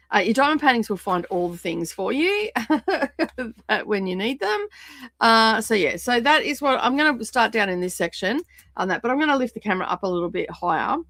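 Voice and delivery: usual speaking voice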